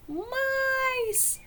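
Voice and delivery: high, long cry of fright; startled shout. Acted. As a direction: yelp it out in alarm. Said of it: "shouted Lemon".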